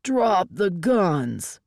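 slow, drawn-out shouted command; hard, deliberate standoff order. A narrator's reading. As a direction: shout it, stretching each word. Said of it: "E I A lookalikes shouted".

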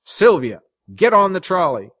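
loud, high-pitched order; shouted command. A narrator's reading. Guitarist commanding her onto the trolley, shouting. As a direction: order her aboard at full voice. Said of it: "he shouted".

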